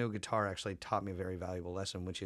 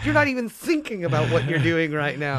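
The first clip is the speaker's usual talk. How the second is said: loud, much higher, disbelieving shout; amazed yell; 8 semitones higher, 12 dB louder than usual. Retold or imitated. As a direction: blurt it out in stunned disbelief. Retelling own amazed reaction.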